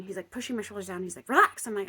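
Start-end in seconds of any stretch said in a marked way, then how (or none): 1.3-1.7 louder, higher command; insistent order. Retold or imitated. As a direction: order it sharply, imitating the healer. Shaman's repeated order, retold.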